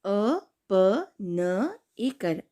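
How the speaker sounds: drawn-out letter by letter spelling; slow syllable spelling. Shown as a demonstration. Teacher spelling a word slowly for kids. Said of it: "अब दूसरा शब्द है".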